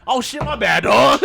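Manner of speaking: high, loud oops moment; mock sheepish apology. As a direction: blurt a hammed-up apology. Retold sudden apology.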